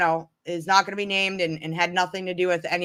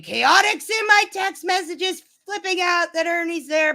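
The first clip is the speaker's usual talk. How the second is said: high, panicky mimic of a friend; mock-frantic girl on the phone; imitating Tracy; 8 semitones higher than usual. Retelling Tracy's frantic call about Chaotic, high and panicky.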